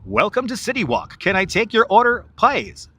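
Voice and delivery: high, sing-song cartoon order-taker; South Park restaurant worker. City Walk worker taking an order, high cartoon voice. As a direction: take the order in a high put-on voice. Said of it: "City Walk ... That's a South Park".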